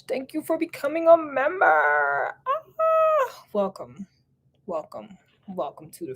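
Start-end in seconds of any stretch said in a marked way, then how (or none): none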